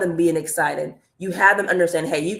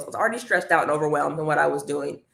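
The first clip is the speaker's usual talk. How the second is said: ordinary talk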